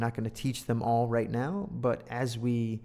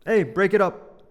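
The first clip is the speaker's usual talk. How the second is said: loud, high call to stop a fight; teacher shouting at fighting kids; teacher breaking up a fight; 6 semitones higher, 9 dB louder than usual. Shown as a demonstration. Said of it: "Hey, go break those guys up".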